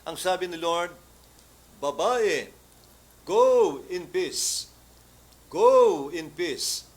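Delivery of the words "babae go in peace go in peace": slow, loud, solemn proclamation; Jesus speaking to the healed woman; Jesus' blessing. Retold or imitated. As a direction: bless her deliberately. Voicing Jesus' gentle blessing.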